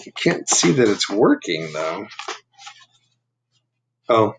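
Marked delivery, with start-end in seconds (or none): none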